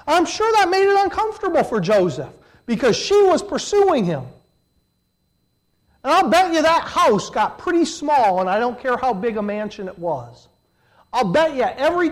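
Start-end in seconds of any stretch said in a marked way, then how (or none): 0.1-8.4 high, loud, lively aside; animated aside. Real, not acted. Preacher to the congregation, high animated aside. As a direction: sell the picture with energy.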